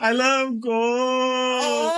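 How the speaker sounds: high, long-drawn mock villain cry; film villain impression. Host quotes an Austin Powers film, drawn-out hammed-up villain voice. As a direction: stretch it out in a hammed-up movie-villain voice. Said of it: "make another Austin Powers".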